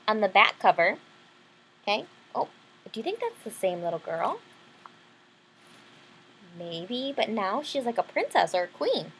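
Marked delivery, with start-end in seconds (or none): none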